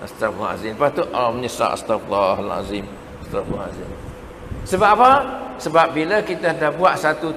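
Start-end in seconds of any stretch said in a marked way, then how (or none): 0.1-3.9 much lower, softer repentant murmur; remorseful sigh. Retold or imitated. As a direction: let the regret sink in. Voicing remorse after killing.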